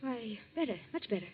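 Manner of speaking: very soft, low, withdrawn voice; subdued, shaken. Acted. Shaken wife answering questions at breakfast, faint and subdued. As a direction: answer faintly, barely voicing it.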